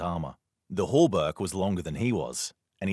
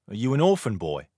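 uncertainly; 4 semitones higher, 5 dB louder than usual. Acted. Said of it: "he asked uncertainly".

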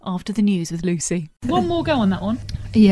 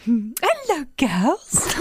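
cat voice; 4 semitones higher, much slower than usual. Pretend. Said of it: "You talk to them with a cat voice".

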